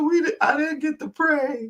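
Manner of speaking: put-on high whiny child voice; mock crying kid. Mom imitating kids upset at missing prayer, high whiny voice. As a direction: whimper it like a kid denied bedtime prayer. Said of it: "they'll start crying 'cause they're like".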